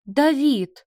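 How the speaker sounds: high, loud exclamation of his name; shocked cry. A narrator's reading. Mother aghast at her son, shocked cry. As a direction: pull him up in shocked dismay.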